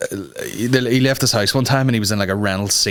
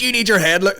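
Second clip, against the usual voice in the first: mock woman scolding; 10 semitones higher than usual. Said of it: "She goes".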